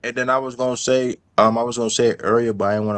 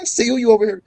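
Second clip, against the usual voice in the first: high teasing callout; mocking tease; 8 semitones higher than usual. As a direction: needle him with a mocking tone. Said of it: "I'd be like".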